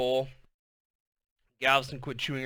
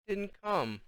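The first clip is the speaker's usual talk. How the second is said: higher, softer sad little voice; sad rasp; 7 semitones higher, 8 dB quieter than usual. A narrator's reading. Sad child telling her mother, soft. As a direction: barely get the words out. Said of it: "filled with so much sadness".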